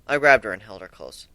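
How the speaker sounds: plain reading voice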